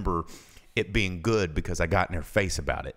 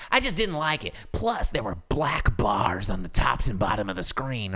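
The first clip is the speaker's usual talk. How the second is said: whiny customer; 3 semitones higher than usual. Retold or imitated. Customer voiced in story.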